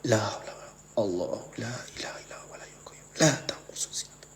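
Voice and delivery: whispered repeated prayer, breathless with fear; frightened whispered prayer. Real, not acted. Alone in the dark haunted room, whispering prayers in fear. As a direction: whisper it over and over to calm yourself.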